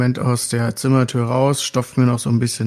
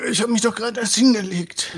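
sleepy whiny voice; 9 semitones higher than usual. Acted. Tired character complains.

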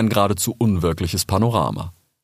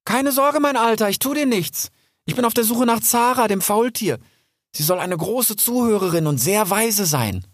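put-on much higher, eager young fox; young fox calling out; 13 semitones higher than usual. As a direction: reassure the frightened old salamander. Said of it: "doch Rix rief". (hoher, eifriger junger Fuchs)

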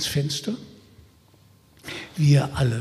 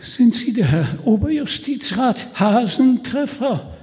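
higher, trembling frightened call; shaky breathless pleading; 9 semitones higher, 4 dB louder than usual. Acted. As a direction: call out, voice shaking with dread. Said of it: "rief der auf unserer Seite, bleich wie der Tod, mit zitternder Stimme". (zitternde, ängstliche Stimme)

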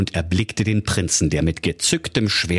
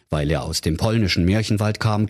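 ordinary delivery

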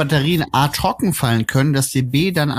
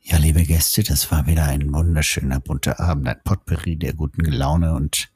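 deep, lower announcer outro voice; booming movie trailer narrator; 9 semitones lower than usual. Acted. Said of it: "Trailer-Stimme".

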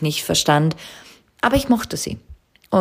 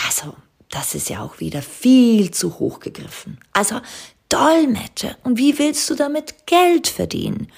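higher-pitched imitation of sceptical relatives; mock disbelief; 8 semitones higher than usual. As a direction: play the doubters, voice climbing into incredulity. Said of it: "andere finden".

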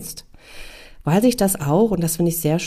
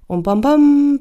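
dramatic punch; 8 semitones higher, 6 dB louder, slower than usual. Acted. Dramatic onset of guilt.

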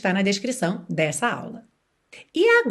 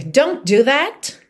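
firm scolding; 3 semitones higher, 5 dB louder, much slower than usual. Shown as a demonstration. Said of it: "Claro que ela podia dizer".

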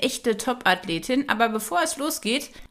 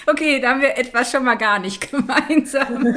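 laughing; 4 dB louder than usual. Real, not acted. Host laughs at guest.

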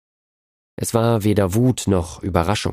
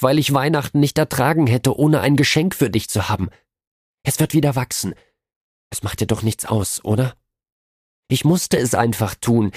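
higher, urgent pleading with a hint of tears; tearful pleading; 4 semitones higher than usual. A narrator's reading. Della pleading with Jim about her cut hair, tearful and high. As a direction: beg him not to look at her like that. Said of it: "rief sie laut schluchzend".

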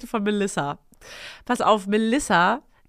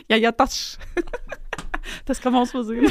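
laughing; 2 semitones higher than usual. Real, not acted. Laughing reading Melissa.

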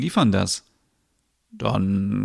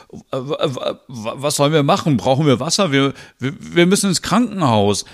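flustered panic; 4 semitones higher than usual. Acted. Panicked reaction to labor news.

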